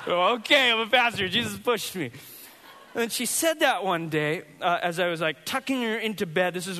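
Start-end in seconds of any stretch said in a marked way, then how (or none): 0.1-2.1 loud, high, hammed-up giving in; mock resigned. Pastor hamming up giving in to his daughter's claim. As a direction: throw up your hands and accept it.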